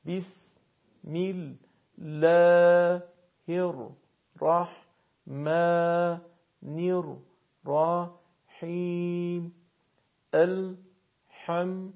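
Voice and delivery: slow, long vowels held on a steady note; measured beats, no melody, clear gaps; syllable-by-syllable recitation. Shown as a demonstration. Teacher repeats verses for learners to practise, slow syllabic recitation. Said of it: "براتون بخش خوانی کردم".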